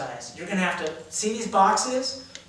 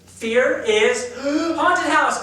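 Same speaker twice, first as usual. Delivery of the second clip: high gasped fearful demo; acting teacher demonstrating fear; 7 semitones higher, 6 dB louder, much slower than usual. Shown as a demonstration. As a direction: show haunted-house fright with a gasp. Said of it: "we're gonna do it with each of these".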